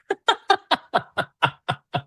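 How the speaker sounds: high, chuckling burst of laughter; real laugh. Real, not acted. Laughs after admitting being triggered.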